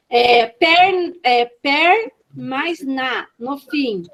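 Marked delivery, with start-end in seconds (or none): 1.6-3.3 very slow, syllables set apart; syllable demonstration. Shown as a demonstration. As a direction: stretch out each piece for learners. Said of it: "manipulação silábica".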